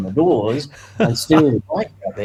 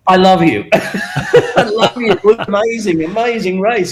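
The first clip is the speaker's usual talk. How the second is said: louder, higher, effusive; imitated gushing joy; 7 semitones higher, 4 dB louder than usual. Owner thanking the rider who won on his bike, gushing. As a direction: gush with delight. Owner overjoyed after the win.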